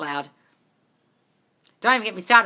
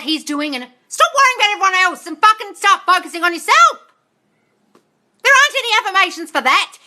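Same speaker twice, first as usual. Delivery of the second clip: much higher, louder scolding yell; 8 semitones higher, 6 dB louder than usual. Real, not acted. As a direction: lay into her at full volume. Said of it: "for yelling at you".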